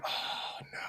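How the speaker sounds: barely voiced, sinking aside; quiet dread. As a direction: breathe it out as it dawns on you. Dread at what he just said.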